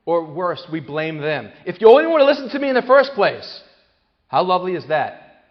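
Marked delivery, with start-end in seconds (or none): 1.7-3.6 much higher, much louder scolding; blaming parent. Retold or imitated. Parent to upset child: loud high blaming scold. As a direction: scold the child for not listening.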